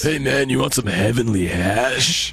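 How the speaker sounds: shady street dealer offer; drug dealer voice. Acted. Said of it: "I love your drug dealer voice".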